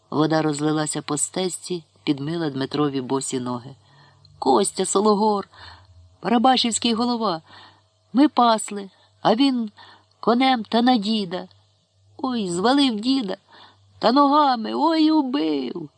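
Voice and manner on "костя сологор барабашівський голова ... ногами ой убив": high, breaking tearful account; sobbing boy. A narrator's reading. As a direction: blurt it out between sobs. Boy sobbing out what happened.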